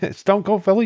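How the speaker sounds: excited. Real, not acted. Laughing greeting to a chat name.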